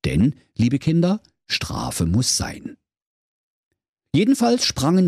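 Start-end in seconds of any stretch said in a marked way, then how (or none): 0.0-2.8 slow whispered moral; stern moral. A narrator's reading. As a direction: deliver the lesson slow and hushed. Moral to children.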